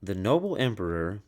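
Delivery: ordinary delivery, nothing marked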